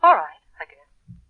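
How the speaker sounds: low, slow, reluctant answer; not very happy. Acted. Ex-wife telling him how the divorce went, unhappy. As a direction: answer half-heartedly, dragging the words out. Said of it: "You don't sound very happy".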